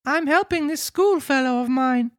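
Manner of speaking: put-on squeaky small boy answering the police; puppet boy. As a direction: Pinocchio answers the officers in a high boy voice. Pinocchio voice.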